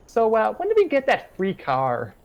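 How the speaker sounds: put-on very high, ditzy valley-girl voice; ditzy Lindsay. Lindsay gushing to Beth, high ditzy voice. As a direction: pitch way up, airheaded and amazed. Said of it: "Lindsay said".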